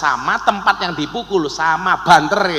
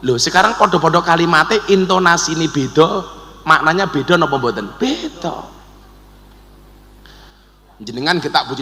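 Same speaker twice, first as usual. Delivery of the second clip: ordinary talk, nothing marked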